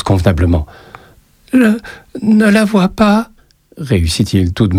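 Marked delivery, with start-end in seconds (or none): 1.5-3.3 put-on higher child's voice, stammering; a scared boy forcing out a greeting; timid little boy. A narrator's reading. Frightened boy. (petit garçon intimidé qui bafouille)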